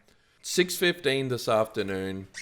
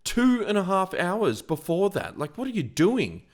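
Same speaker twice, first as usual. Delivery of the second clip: exasperated rant; 11 semitones higher, 4 dB louder than usual. Retold or imitated. To the late delivery driver, high indignant rant. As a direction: build the outrage, pitched way up. Retold angry complaint to driver.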